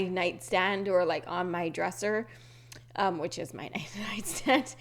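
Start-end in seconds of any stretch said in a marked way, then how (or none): none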